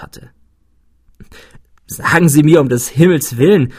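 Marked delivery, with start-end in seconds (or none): 1.9-3.7 loud, imploring exclamation; exclaiming. A narrator's reading. Narrator begs his friend for his method, exclaiming. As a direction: cry out for an explanation. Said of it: "rief ich aus".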